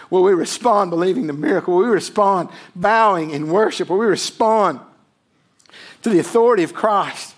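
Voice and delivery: rising preaching. Real, not acted. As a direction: build the appeal with force. Preacher building to climax.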